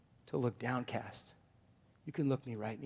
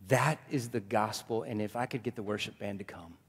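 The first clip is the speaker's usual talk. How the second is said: usual speaking voice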